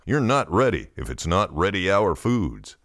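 plain reading voice

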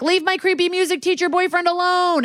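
put-on high, pleading teen girl voice; hammed-up teenager begging; mock whiny teen. Girl begging her mom, high mock teen whine. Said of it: "And Haley is like".

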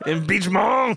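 imitating a hammed-up French pronunciation; mimicked French accent. Co-host echoes French Trump's word, mimicked French accent. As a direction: echo it in mock French.

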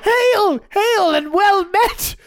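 high, slow, proclaiming herald voice; medieval herald. Pretend. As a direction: hail the brave traveler grandly. Mock herald greeting.

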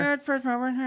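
high mocking echo; mocking imitation. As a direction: parrot him in a silly voice. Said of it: "Trying to rush me".

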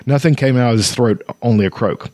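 plain reading voice

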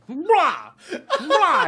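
high squawking bro; mutant chicken. Retold or imitated. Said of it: "like a mutant chicken".

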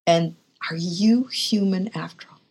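hushed whisper of the coworker's words; whispered retold question. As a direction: breathe it out as a whisper. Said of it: "whisper heard on line".

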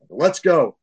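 impatient parent calling; hurried call to the kids. Retold or imitated. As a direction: hurry the kids along, calling out. Rushing kids to the car.

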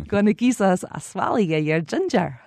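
"Gonna giza us a swally of your ginger" run together in a broad Glasgow accent; broad Glaswegian. Shown as a demonstration. Guide repeating the phrase in Glaswegian on request, broad Glasgow accent. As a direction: slur it into one Glaswegian phrase. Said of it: "say it again in Glaswegian".

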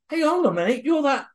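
retold shock at spotting the vanishing old man; startled. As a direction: catch the stranger out mid-sentence. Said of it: "And I'm saying".

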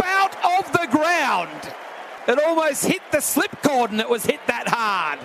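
bowled!, rising call; cricket commentator. Acted. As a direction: lift sharply for the wicket. Live wicket call, bowled.

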